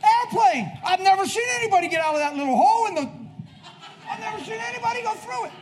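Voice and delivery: high, shouted comic rant; comic loud outburst. Pretend. Preacher joking to church about airplanes, comic shouting. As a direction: blurt it out at high pitch.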